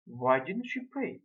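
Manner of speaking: usual speaking voice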